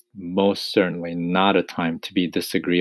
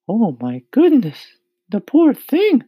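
high, loud ladybug exclamation; ladybug crying out; 9 dB louder than usual. A narrator's reading. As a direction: cry out in high-pitched concern. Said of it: "the ladybug cried".

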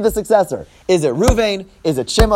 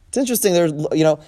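normal talking voice

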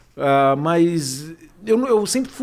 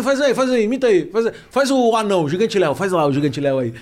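pestering fan; 3 semitones higher than usual. Retold or imitated. Fan nagging the impressionist to do Gigante Léo, pestering. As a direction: imitate a pushy guy badgering for an impression. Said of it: "O cara que fala".

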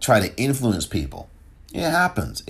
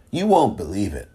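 ordinary delivery

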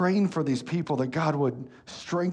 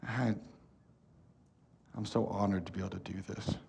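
quiet sincere; 4 semitones lower, 7 dB quieter than usual. Real, not acted. Quiet confession before praying.

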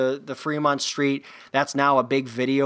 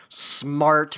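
usual speaking voice

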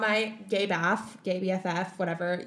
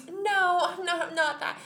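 flustered friend; 8 semitones higher than usual. Retold or imitated. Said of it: "and he's like".

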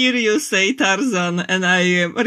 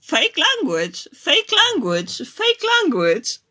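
mock robotic dismissal, rising higher; each repeat pitched higher; Google voice; 4 semitones higher than usual. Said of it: "because Google is like".

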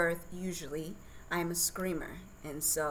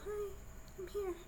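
squeaky, much higher and softer; playful newborn voice, pitched way up; tiny baby voice; 9 dB quieter than usual. Retold or imitated. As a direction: voice the newborn's first little squeak. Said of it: "this little squeak".

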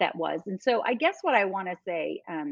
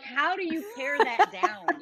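much higher, slow, mock-overwhelmed question; squeaky high comic disbelief; overwhelmed quote; 13 semitones higher, slower than usual. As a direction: act out being swamped with too many choices. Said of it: "I'm like".

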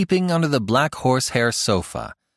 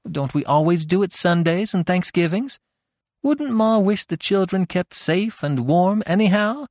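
spirited; 9 semitones higher than usual. A narrator's reading. Tilly talking Eve into heating the best room, spirited. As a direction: sweep her sister along. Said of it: "Tilly spoke with such spirit".

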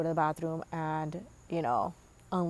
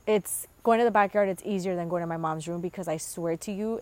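ordinary talk, nothing marked

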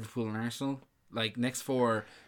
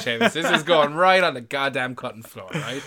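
loud, higher-pitched joking rant; playful blustering threat, raised voice; mock outrage; 3 semitones higher, 10 dB louder than usual. Real, not acted. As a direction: protest loudly in mock anger at your co-host. Mock outburst at being caught wrong.

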